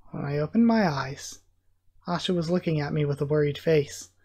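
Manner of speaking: plain reading voice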